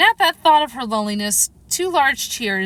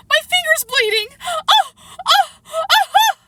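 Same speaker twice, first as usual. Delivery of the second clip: very high, loud steam-whistle shrieks; screaming; 6 dB louder than usual. A narrator's reading. The Queen shaking her hand, screaming in alarm. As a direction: shriek the words, then burst into screamed ohs. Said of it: "Her screams were so exactly like the whistle".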